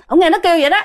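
usual speaking voice

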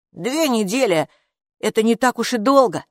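reassuring brush-off. A narrator's reading. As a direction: toss off «Две недели!» as no big deal. Капо countering mother's dismay.

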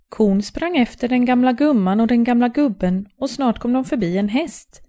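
ordinary delivery, nothing marked

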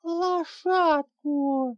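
put-on high, very slow, drawn-out child's plea; the girl insisting on her pony. A narrator's reading. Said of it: "уже увереннее повторила драконица".

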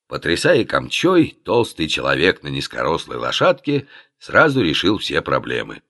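usual speaking voice

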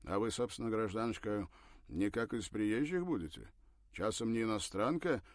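loud, wary, probing questions; suspicious man. A narrator's reading. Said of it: "сменилась подозрительностью".

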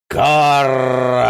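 high loud toad cry; croaking toad shout. Acted. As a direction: bellow it like a fat toad. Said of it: "вдруг кричит одна".

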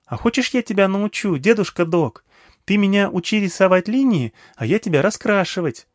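put-on high, eager child voice; small girl eagerly addressing an old man; little girl. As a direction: pitch it up like a chatty little girl. Narrator voices little girl Nagma.

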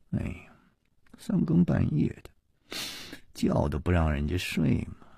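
soft, sleepy-hour grumble under the breath; muttered grumbling. A narrator's reading. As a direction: mutter your complaint softly. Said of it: "喃喃地嘀咕着说". (不高兴地小声嘀咕)

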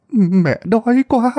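put-on high, slow old woman's plea with quá drawn out; beg, leaning on quá; whimpering plea. A narrator's reading. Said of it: "bà cũng mếu máo mà nói".

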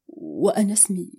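plain reading voice

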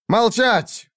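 sharp high shout for silence; shouted command. A narrator's reading. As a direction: bark it to silence the hall. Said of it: "выкрикнул Шах".

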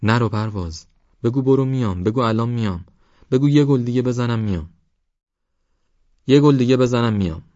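plain reading voice

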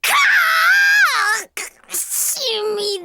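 stung by medicine on a burn; pained wince. Acted. Ointment stings the burn.

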